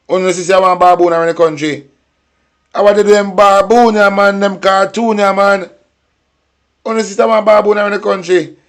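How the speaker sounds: fired-up outrage at the Prime Minister; heated rant. Real, not acted. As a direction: rail at him, voice climbing. Heated rant against Holness.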